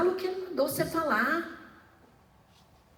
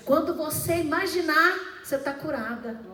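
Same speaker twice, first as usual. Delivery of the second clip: much higher, louder fervent preaching cry; preaching shout; 6 semitones higher, 5 dB louder than usual. Real, not acted. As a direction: lift the church with a rising shout. Preaching climax on healing.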